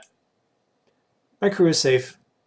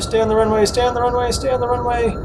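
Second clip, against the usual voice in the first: nagging backseat driver; 8 semitones higher than usual. Real, not acted. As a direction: bark the orders at the pilot. Urging his landing like a passenger.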